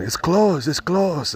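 high-pitched mock yell of the janitor; imitating yelling attendant. Janitor yelling at a customer who must pee, high mock yell. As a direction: squeak it out like the upset cleaner. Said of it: "So he started yelling".

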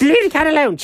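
sudden very high, odd voice; weird cat voice. Acted. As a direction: snap out a high-pitched order as the cat. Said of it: "Uh, that was weird".

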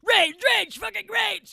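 high, loud hammed-up rage chant; mock rage. Acting out the hormone monster, high and loud. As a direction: roar it like a cartoon monster.

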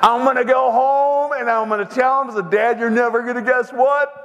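high, excited schoolboy boast; bursting to share the news, pitched high; gleeful boy. Retold or imitated. Beaten boy can't wait to tell Dad, gleeful excitement.

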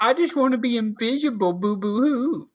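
high, hammed-up whining sob; mock blubbering boo hoo; fake crying. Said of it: "I'm kidding, Bryce".

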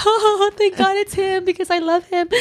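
high, bright burst of joyful relief; overjoyed relief. Retold or imitated. Telling friend how she reacted to the sign, high overjoyed relief. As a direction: gush it out like the answer just came. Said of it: "I was like".

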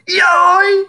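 sudden high yelp of a dying Jango; Jango death cry. Retold or imitated. Podcaster imitating Jango's game death cry. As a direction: yelp it out like a hit bounty hunter. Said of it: "Jango's another good one, where he goes".

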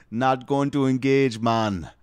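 hammed-up Jamaican voice; mock Jamaican accent. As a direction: declare it in a put-on Jamaican accent. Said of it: "do a Jamaican voice".

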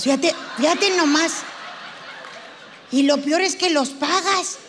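ordinary talk

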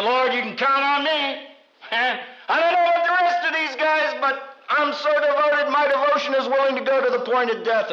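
high, bragging pledge of devotion; high-pitched boast, speeding up at the end; boastful Peter. Retold or imitated. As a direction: Peter puffing himself up. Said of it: "the man who said".

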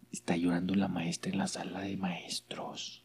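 hushed, slow gossiping whisper; a conspiratorial whisper. Retold or imitated. Classmate reporting secretly.

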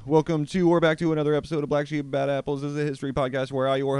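podcast host intro. Acted. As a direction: open the show like a radio host.

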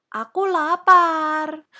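high, loud, drawn-out complaint; hungry child's wail. Acted. Hungry princess demands food.

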